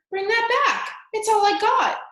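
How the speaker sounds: desperate shout. A narrator's reading. Prisoner shouting after the rat, desperate and loud. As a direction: cry out for the only thing he has. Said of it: "shouted the prisoner".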